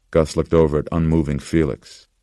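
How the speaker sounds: plain reading voice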